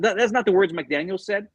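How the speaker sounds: normal talking voice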